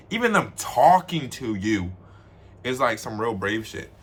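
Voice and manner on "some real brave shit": ordinary delivery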